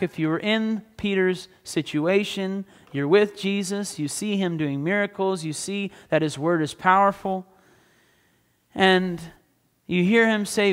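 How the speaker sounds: ordinary talk, nothing marked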